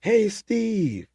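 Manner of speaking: high mock yell of the name; called-out name. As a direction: shout it back across the sea. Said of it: "And he goes".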